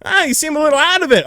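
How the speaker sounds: high, quick mocking cop; fast, nasal-free, squeaky officer impression; officer noting he seems out of it. Cop voiced in story.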